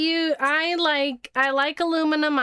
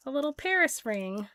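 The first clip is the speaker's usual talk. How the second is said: normal talking voice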